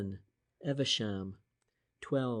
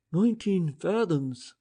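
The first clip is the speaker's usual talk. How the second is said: higher, breathless gasp of astonishment; 6 semitones higher, 4 dB louder than usual. A narrator's reading. Price stunned by the wreck's shallow depth, gasping. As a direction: gasp it out in awe. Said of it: "Price gasped at last".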